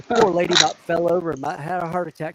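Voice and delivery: ordinary talk, nothing marked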